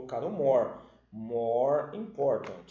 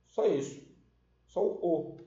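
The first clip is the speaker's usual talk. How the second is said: ordinary talk, nothing marked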